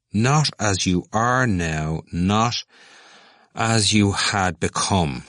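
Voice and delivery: slow, deliberate echo of the other's words; slow repeating. A narrator's reading. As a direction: repeat it back slowly, weighing each phrase. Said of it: "Cranley repeated".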